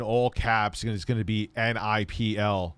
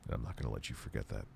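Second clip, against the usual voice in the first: much lower, softer threatening voice; low menacing bird; 7 semitones lower, 15 dB quieter, faster than usual. Pretend.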